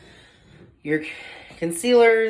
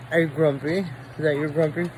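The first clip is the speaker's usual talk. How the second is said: ordinary delivery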